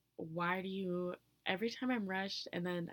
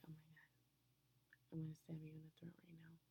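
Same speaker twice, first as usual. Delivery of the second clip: very soft, hushed fast mutter; seething aside kept almost silent; muttered threat; 14 dB quieter, much faster than usual. Retold or imitated. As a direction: mutter the threat under your breath, barely audible. Acted muttered threat after quote.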